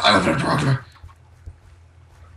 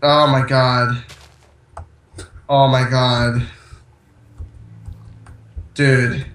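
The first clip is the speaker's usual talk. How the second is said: miserable groaning; much slower than usual. Real, not acted. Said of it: "You sound so miserable".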